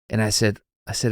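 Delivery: ordinary talk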